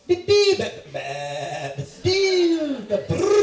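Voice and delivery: high, loud bird shrieks broken by squawks and a trill; bird call. Retold or imitated. Comic imitating the bird nobody can stop, bird call. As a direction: imitate a noisy bird at full volume. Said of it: "waking up to some bird going".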